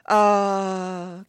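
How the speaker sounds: high, loud drawn-out sympathetic aah; audience sympathy. Retold or imitated. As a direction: moan along with the crowd. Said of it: "commiserated the audience".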